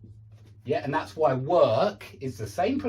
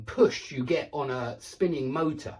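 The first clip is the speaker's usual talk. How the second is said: usual speaking voice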